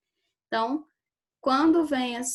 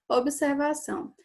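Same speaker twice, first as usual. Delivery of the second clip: usual speaking voice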